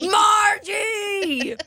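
very high shrieking call; high crying shout; shrieked name. Pretend.

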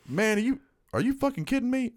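high-pitched incredulous quote; retold disbelief. Guy asking for money, told no, incredulous. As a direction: imitate someone in disbelief, voice jumping way up.

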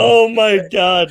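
delighted, slowed oh my God; drawn-out delight. Real, not acted. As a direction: savour the joke, stretching it. Delighted reaction to joke.

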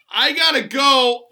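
hasty sheepish goodbye. Retold or imitated. Acting out sheepish escape from stranded woman.

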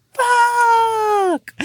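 high, loud anguished cry; anguished curse. Retold or imitated. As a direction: wail the word in despair. Watching kids roll in shit.

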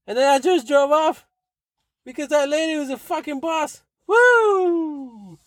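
high-pitched, gleeful crowing; exult, ending on a long high woo; triumphant cheer. Real, not acted. Celebrating the lady, high whooping.

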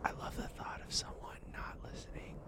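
very soft whisper; quiet, voiceless ASMR murmur; playful ASMR whisper. Pretend. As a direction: join in with a hushed whisper.

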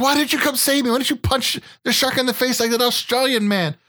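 high, whiny mock wife; wife's complaint. Wife scolding him for not punching the shark, whiny. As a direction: whine it like a betrayed wife. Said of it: "she'd be like".

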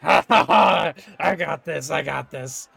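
loud mocking laugh, then a smug boast; gloating arm wrestler; cocky gloat. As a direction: crow over the win, then settle smugly. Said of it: "and he's like".

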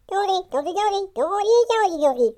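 high, loud gurgling fish noises. Acted. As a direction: bubble it out like a fish talking underwater. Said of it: "And everyone else hears".

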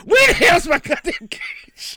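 high, slow hammed-up pirate demand. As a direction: growl like a pirate wanting his cage. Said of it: "showing up and being like".

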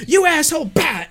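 high yelled insult with a kick sound; mock shout. Little sister yells at her sister while kicking, mock shout. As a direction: yell it high like a bratty kid attacking. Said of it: "Sawyer comes in and goes".

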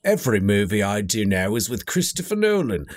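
mock Cockney veteran-actor impression; Michael Caine. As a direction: put on a London film-star accent. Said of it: "in the Michael Caine accent".

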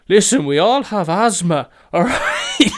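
very loud, high mock teen whine; hammed-up shouted kid excuse; mimicked kids protesting. Gang of kids argue back at the woman, loud mock protest. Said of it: "they just go".

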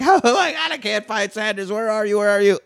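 high, mock-panicked fretting; hammed-up flustered panic; panicked searching. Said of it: "she's trying to find sadness. She's like".

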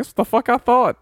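loud, high-pitched reenacted taunt; retold cocky comeback. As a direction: call it after him, replaying a victory. Retells his triumphant comeback.

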